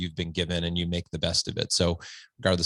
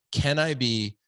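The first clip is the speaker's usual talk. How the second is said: lightly hammed-up self-satisfied dad, a bit higher; mock preachy dad; 4 semitones higher than usual. Dad mocking himself lecturing his son, smug and a bit higher. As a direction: play the smug dad showing off his wisdom. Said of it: "But to say".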